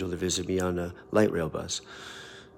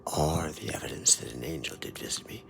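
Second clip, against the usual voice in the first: lower, soft, half-whispered finish; softer, lower and slow to the amen; hushed closing; 6 semitones lower, 7 dB quieter than usual. Acted. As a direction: confide the ending in a hush.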